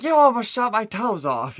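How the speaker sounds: high, loud mock yell of the colonel; mock yelled quote. Host retelling the scene, yelling the officer's line in mock outrage. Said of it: "he's like".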